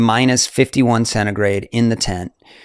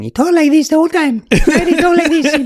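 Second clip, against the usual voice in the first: put-on very high squeaky Italian accent; comically epic high-pitched voice; Simone Moro; 5 dB louder than usual. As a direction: imitate an Italian in a high, loud falsetto. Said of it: "Simone has this voice that is just epic".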